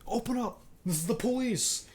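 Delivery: high mock cop shout through the door; police shout. Cop banging on the door, mock shouting to open up.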